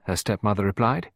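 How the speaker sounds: ordinary delivery, nothing marked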